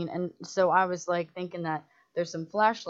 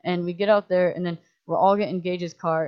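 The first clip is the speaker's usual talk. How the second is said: normal talking voice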